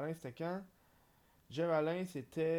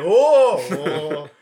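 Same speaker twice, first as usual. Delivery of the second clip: crowd oohing; 12 dB louder than usual. Pretend. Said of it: "le podcast fait".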